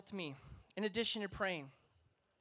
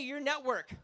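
usual speaking voice

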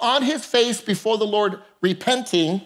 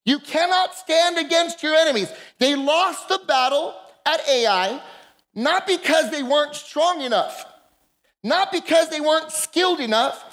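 rising, building preacher's cadence; preaching; 4 semitones higher than usual. Acted. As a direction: build it up from the pulpit.

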